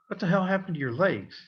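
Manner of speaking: a father shocked at his son's bruises; alarmed dad. Retold or imitated. Dad sees bruised legs, asks fast and high in alarm.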